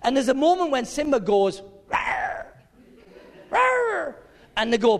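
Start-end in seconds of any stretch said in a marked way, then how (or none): 1.9-4.2 tiny high squeaky roar; a little lion cub trying to roar; cub's whimpery roar. Retold or imitated. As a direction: feeble mock roar. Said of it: "they laugh at his whimper".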